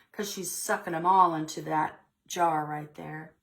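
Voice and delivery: usual speaking voice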